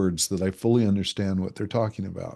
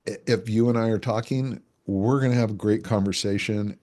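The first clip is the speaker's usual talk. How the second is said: normal talking voice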